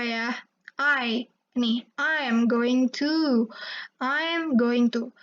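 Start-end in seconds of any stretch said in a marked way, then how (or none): 2.0-5.2 slow I am going to with I said as in eye; slow English model reading. Shown as a demonstration. Teacher modelling I am going to slowly for students. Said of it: "I itu dibacanya ya I aja".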